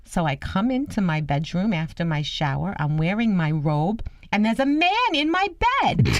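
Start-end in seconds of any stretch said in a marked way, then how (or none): 4.3-6.1 high, scandalised grandmother quote; mock alarm, pitched right up; retold shock. Grandmother telling granddaughter about the intruder, shocked.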